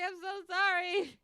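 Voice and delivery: very high, loud, slow wail, laughing while crying out; mock wailing apology. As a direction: wail the apology, half laughing, half fake-sobbing. He hit her trap again.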